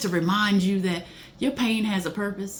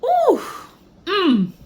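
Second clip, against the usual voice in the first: loud high ooh sliding into a hum; moved ooh; 13 semitones higher, 7 dB louder than usual. Real, not acted. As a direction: cry out, overcome by the words. Moved by the lyric.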